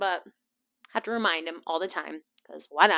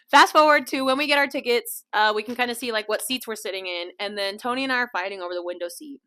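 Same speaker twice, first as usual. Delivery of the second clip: normal talking voice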